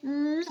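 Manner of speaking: exaggerated "mwah" with a hummed wind-up; big kiss smack. Shown as a demonstration. Teacher showing children grandma's kiss, exaggerated smacking mwah. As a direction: plant a big smoochy kiss sound.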